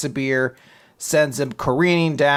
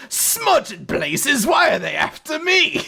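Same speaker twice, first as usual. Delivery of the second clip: high, slow, shouted British exclamation, hammed up; silly villain voice; 11 semitones higher, slower than usual. Weiss fleeing the heroes, hammed-up high shout. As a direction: cry out like a flustered pantomime villain. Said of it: "I gave him that voice".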